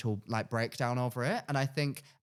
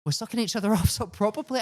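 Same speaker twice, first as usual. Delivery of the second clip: cheeky matter-of-fact; 7 semitones higher than usual. Retold or imitated. Retold quip, higher.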